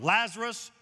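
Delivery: Jesus commanding the dead Lazarus to rise; calling out. Acted. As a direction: call him out of the tomb. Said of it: "And Jesus called out".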